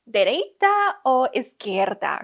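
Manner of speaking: put-on slow, emphatic Brazilian driver's accent. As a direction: draw it out in a Brazilian Portuguese accent. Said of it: "in his accent".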